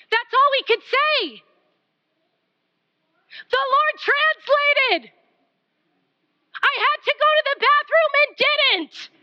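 high, driving sermon voice; full-force preaching. Acted. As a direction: exhort the congregation with force.

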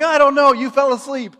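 high, loud helpless excuse; defensive protest. Retold or imitated. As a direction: whine it loudly in self-defence. Defending himself to brother.

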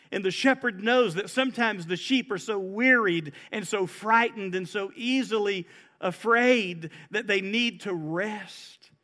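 normal talking voice

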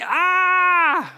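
high, drawn-out "ah" cry; imitated drowning yell. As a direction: yell like a man going under. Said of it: "as he's going down yelling".

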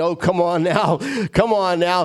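lightly urging oneself on; coaxing own soul. Acted. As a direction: coax your own soul along. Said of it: "like you're talking to your soul".

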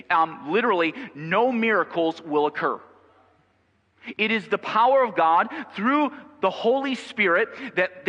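high, loud, emphatic preacher voice; impassioned pulpit delivery; fervent preaching. Real, not acted. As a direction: preach it with rising force to the church. Preaching at full force.

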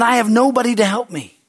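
high, self-pitying whine; whiny excuse. Retold or imitated. Man at the pool pitying himself, high whine. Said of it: "but he said".

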